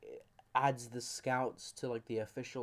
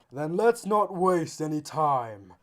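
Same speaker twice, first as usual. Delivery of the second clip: slow, loud, drawn-out declaration; heroic voice; 7 dB louder, slower than usual. Acted. A voice heard as the axe glows, slow loud heroic declaration. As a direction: proclaim it loud and stretched out. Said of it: "and you hear a voice".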